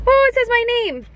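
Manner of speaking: high, excited squeal; delighted surprise. Real, not acted. Vlogger finds her name on a gift, delighted squeal. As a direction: squeal at a happy discovery.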